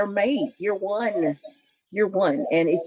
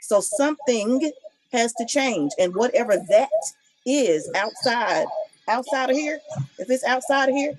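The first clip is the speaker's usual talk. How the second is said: higher-pitched, passionate insistence; 6 semitones higher than usual. Real, not acted. Co-host insisting on respect for an uncomfortable spouse, passionate. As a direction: make the point with rising fervor. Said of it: "I just get kinda passionate about that".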